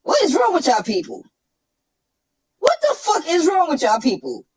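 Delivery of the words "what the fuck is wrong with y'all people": ordinary talk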